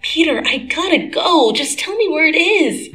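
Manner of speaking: high urgent plea. Acted. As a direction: hurry him along. Urgent demand for suit.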